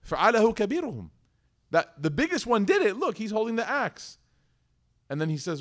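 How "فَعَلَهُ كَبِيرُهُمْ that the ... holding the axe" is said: high, mocking sarcasm; lightly voiced sarcastic retort; sarcastic reply. Ibrahim mocking the idol worshippers, sarcastic and high. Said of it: "He replied sarcastically".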